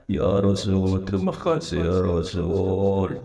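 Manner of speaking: a grateful servant, low and slow; grateful slave. Acted. Slave thanks the Prophet for the money, low and slow.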